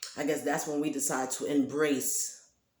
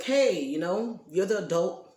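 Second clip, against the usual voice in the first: higher, louder, slow scolding shout; retelling a yell at her daughter, higher and louder; retold yelling; 5 semitones higher, 6 dB louder than usual. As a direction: tell her off with a raised voice. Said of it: "basically yelling at her".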